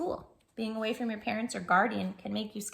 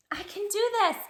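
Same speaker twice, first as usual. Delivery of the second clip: put-on confident child; 11 semitones higher, 4 dB louder than usual. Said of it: "repeat after me".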